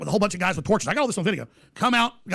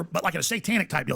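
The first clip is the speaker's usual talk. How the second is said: normal talking voice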